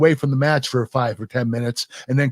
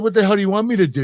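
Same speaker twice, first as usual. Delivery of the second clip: higher, fast, indignant comeback; exasperated retort; 7 semitones higher, much faster than usual. Retold or imitated. To Stephanie after the fish jibe, fast exasperated retort. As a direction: fire it back fast and high, annoyed.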